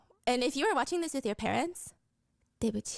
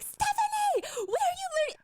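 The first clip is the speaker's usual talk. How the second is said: shocked friend. Retold or imitated. Friend Tiffany reacts to the rude phrase, shocked. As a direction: gasp at what she just heard. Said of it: "She's like".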